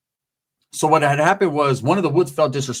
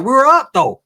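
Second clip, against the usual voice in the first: higher, louder whiny protest; 9 semitones higher, 5 dB louder than usual. Acted. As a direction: plead your case up high.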